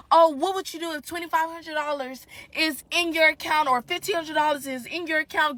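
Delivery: high, loud, sneering imitation of a scam pitch; mock the scammer's silly question. Ranting to followers, mocking a DM scammer's loud pitch. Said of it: "some foolishness about".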